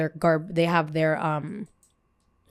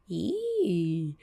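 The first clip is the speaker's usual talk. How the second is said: ordinary delivery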